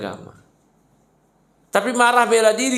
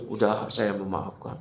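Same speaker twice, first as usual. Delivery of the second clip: low, soft mock forgiveness; mock forgiving husband; 11 semitones lower, 7 dB quieter than usual. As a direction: imitate a meek husband letting it go. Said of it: "Bodoh itu kalau dia".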